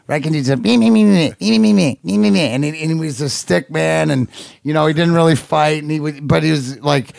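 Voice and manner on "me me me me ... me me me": higher, rapid mock yapping; Sean Burr. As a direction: imitate a pesky agitator's nagging chatter. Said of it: "we used to call Sean Burr Skippy".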